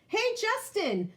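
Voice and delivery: a lightly voiced kid calling. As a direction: call across the playground. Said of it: "Emilio calls out".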